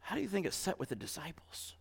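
sudden hushed whisper. Real, not acted. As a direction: lean in and whisper the question.

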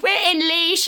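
perky mock announcement; imitating friend. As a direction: chirp it as an imitation of the driver. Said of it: "she's like".

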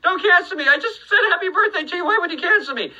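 high-pitched comic panic; hammed-up frantic protest; mock pleading. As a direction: plead in a high voice, half laughing. Mock plea after accent joke.

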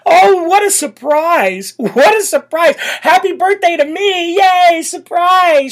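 high, slow, hammed-up fake surprise; mock surprised Kamala. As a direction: mimic her gushing, mocking. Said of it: "She's like".

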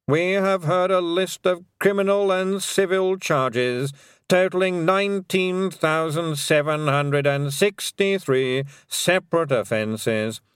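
slow, measured legal reading; dry solemn lawyer; formal lawyer. Acted. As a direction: read the charges gravely. Lawyer reads charges.

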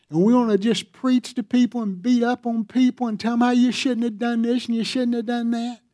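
rising to a full-force sermon peak; fiery preaching. Acted.

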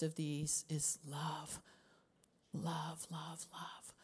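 a reverent, breathy hush on love; soft whisper fading out. Real, not acted. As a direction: whisper it, fading to almost nothing. Intimate climax on love.

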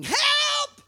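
high, piercing cries for help; stranded child shrieking for help; screaming for help. Retold or imitated. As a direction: call out from the stuck ride seat. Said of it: "Both of us are screaming".

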